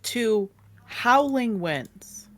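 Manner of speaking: slow, suspenseful narration with long pauses; dramatic scene setting. A narrator's reading. Game master wakes players to a massive storm, slow and suspenseful. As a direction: draw out each image for suspense.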